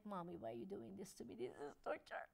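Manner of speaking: a quiet, whiny dog voice, lightly done; dog complaining. Retold or imitated. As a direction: play the dog begging mommy to stop. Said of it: "And he's like".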